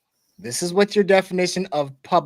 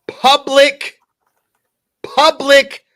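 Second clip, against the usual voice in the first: public said much higher and louder, set apart by pauses; stress on public; 8 semitones higher, 6 dB louder, much slower than usual. Real, not acted. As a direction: hammer each "public" high and loud, pause between. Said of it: "and emphasis on".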